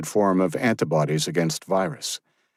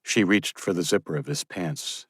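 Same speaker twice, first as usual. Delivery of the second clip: usual speaking voice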